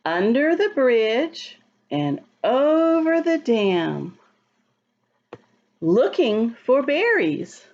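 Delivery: slow, sing-song rhyme reading; reading to children. Acted. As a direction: read the rhyme slowly to little kids. Reads the rhyming book aloud.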